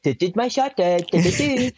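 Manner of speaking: high sing-song announcement; playful video imitation. Recalling old shot day Snapchats, high playful sing-song. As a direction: chirp a silly little intro jingle. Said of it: "being like".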